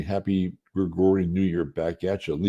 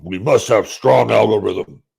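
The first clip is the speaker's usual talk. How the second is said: mock commander; 4 semitones higher, 9 dB louder than usual. Mock-commanding line after plain request.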